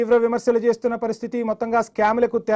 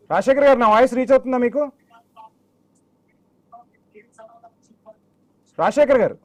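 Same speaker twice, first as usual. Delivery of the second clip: raised, higher-pitched check on the line; calling out; 5 semitones higher, 3 dB louder than usual. Real, not acted. Anchor checking whether remote guest hears him, calling out. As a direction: call out to an unresponsive remote guest.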